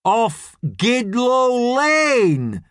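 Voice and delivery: high, drawn-out shouted reply; slow, exaggerated call, every word stretched; shouting back. A narrator's reading. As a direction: shout back just as slowly. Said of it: "shouts back Dalil".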